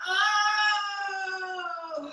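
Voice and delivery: high, long "oh" of a hug; big hug squeal. Pretend. Teacher hugging child in yoga class, high drawn-out oh. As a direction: draw out a high cooing oh.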